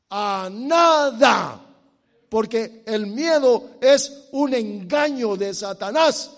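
loud, slow-building sermon proclamation; full-force preaching. Real, not acted. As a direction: preach it out with hammered stresses.

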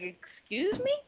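high, soft, disbelieving question; can't believe she's blaming him, pitch it up; incredulous. Retold or imitated. Answering his date's accusation, incredulous and soft.

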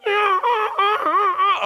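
hee-haw it up like a fleeing donkey; donkey bray. Retold or imitated. The donkey running up the hill, braying. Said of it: "the donkey just goes".

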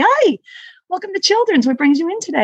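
high, sing-out welcome easing down; re-enacted over-bright front desk hello; chirpy greeting. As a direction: greet arriving families with big pep. Acting out her greeting to families.

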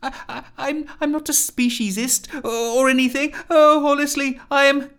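squeaky, anxious self-defence; flustered pleading. Acted. Rusty keeps pleading, stammering.

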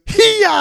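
high, loud mock karate shout "Hiya"; hammed-up kung fu yell; imitating a karate yell. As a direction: belt out a cartoon martial-arts cry. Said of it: "the music goes".

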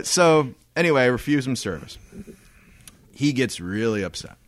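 normal talking voice

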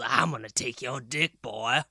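a hint of a happy leering old man; gleeful old man. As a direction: lightly voice an old man delighted by his threat. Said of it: "so excited to take my".

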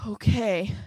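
plain reading voice

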